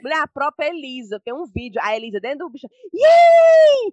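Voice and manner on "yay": very high, loud squeal; gleeful kid cheer. Retold or imitated. Imitating Elisa in the video on the truck, gleeful squeal. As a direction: let out a child's thrilled whoop.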